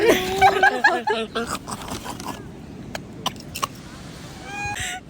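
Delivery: giggling fit, high-pitched; helpless high laugh. Real, not acted. Birds lined up at food.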